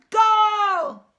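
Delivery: loud, high goal shout; triumphant yell; victory yell. A narrator's reading. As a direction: roar it again and again. Repeated goal celebrations.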